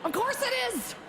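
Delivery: sing-song high yes, of course; breezy high-pitched reply; mock chirpy yes. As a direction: pitch it up brightly, mock-innocent. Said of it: "I'm like".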